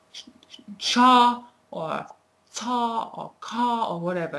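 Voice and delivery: cha, ta and ka as the wrong start of to; showing how NOT to start the word; wrong sounds. Shown as a demonstration. Teacher shows learners the wrong way to say it, exaggerated. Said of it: "Don't, don't say".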